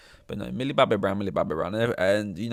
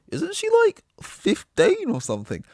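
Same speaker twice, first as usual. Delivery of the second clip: much higher incredulous squeak; mocking tweeter; 5 dB louder than usual. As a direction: pitch it up like a clueless poster. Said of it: "it's just like".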